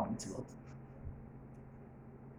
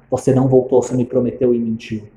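ordinary delivery

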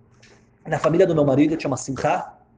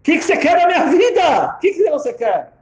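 exasperated shout; 14 semitones higher, 6 dB louder than usual. Retold or imitated. Crying woman to persistent stranger, exasperated shout. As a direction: shout it at someone who won't leave.

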